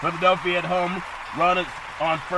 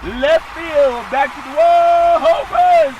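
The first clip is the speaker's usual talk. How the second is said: building play-by-play, rising to a shouted higher call; announcer calling a homer to the wall, louder each phrase; home run call; 8 semitones higher, 8 dB louder, much slower than usual. Acted. Sportscaster to the crowd, building to a roaring home run call.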